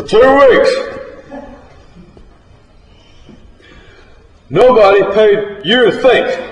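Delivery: high, loud, bellowing rant; furious drunk foreman laying down the law; drunken angry tirade. Acted. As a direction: raise hell like a drunk boss. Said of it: "He starts to raising all kind of hell".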